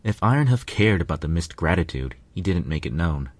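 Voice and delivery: ordinary delivery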